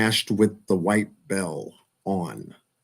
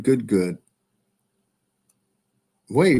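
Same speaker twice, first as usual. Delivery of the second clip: usual speaking voice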